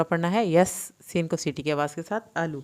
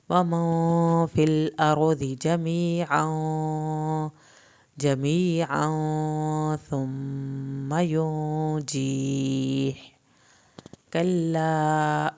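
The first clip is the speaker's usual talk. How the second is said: slow recitation; much slower than usual. Shown as a demonstration. Teacher recites verses to students, slow chant. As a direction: recite slowly, stretching the vowels. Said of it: "teacher models the recitation".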